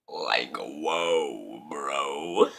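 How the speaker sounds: very deep, drawn-out voice; comic awe. Retold or imitated. Burglar awed by the gun, comic deep voice. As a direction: sink way down for mock amazement.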